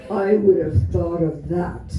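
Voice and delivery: ordinary delivery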